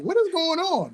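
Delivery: high-pitched bystander reaction; mock onlooker's alarm. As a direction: imitate a startled onlooker, pitch way up. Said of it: "watch people react like".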